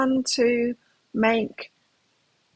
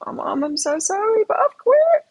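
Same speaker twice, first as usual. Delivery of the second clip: son's voice; 12 semitones higher, 5 dB louder than usual. Retold or imitated. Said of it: "and he's like".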